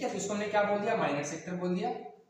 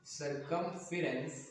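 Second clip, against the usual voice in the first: softer, very slow, word by word; slow dictation; 5 semitones lower, 7 dB quieter, much slower than usual. Real, not acted. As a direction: say it slowly as if writing it out, pausing between words. Repeats term slowly, as if writing. (धीमे, रुक-रुक कर लिखते हुए बोलना)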